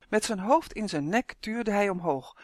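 ordinary delivery

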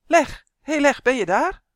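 high, loud calling out to a friend who is missing; hopeful loud call for the dragon. Acted. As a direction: call his name across the room, hoping for an answer. Boy calls out for dragon.

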